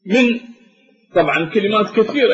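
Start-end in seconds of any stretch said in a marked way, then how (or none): none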